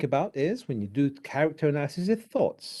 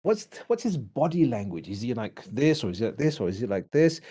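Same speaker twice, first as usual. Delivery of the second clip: usual speaking voice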